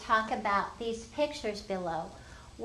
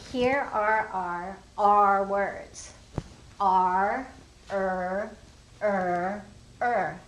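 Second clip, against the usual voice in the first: slow, loud, drawn-out R and er sounds; teacher modeling letter sounds slowly and loudly; slow sounding out; 5 dB louder, much slower than usual. Shown as a demonstration. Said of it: "And I would say".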